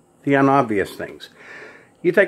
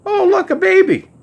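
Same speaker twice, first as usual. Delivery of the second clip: put-on high, loud coo over a baby; delighted cooing; 6 dB louder than usual. Passing couple spots a baby in a carriage, delighted cooing.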